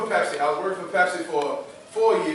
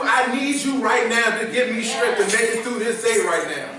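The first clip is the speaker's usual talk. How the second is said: higher, louder testimony preaching; full-force preaching; 6 semitones higher, 3 dB louder than usual. Acted. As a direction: preach it out to the congregation.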